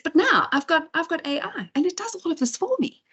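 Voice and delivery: high, imitated Irish accent. Retelling the presenter's talk for an audience, imitated Irish accent. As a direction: put on a high-pitched Irish lilt. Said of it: "in that Irish accent".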